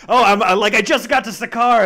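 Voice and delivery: mock breathless arrival. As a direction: blurt it out, late again.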